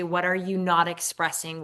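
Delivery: ordinary talk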